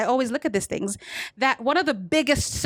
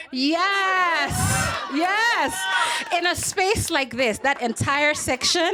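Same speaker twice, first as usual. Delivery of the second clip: excited shout; 8 semitones higher, slower than usual. Real, not acted. Excited crowd boast.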